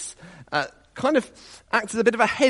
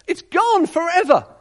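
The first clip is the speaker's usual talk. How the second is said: loud, high-pitched exclamation; ringing, raised-voice declaration of good news; triumphant proclamation; 13 semitones higher, 6 dB louder than usual. Real, not acted. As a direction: proclaim it at the top of your range. Preacher proclaiming condemnation is gone.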